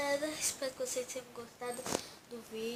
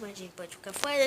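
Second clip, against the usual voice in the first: normal talking voice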